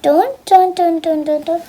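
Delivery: usual speaking voice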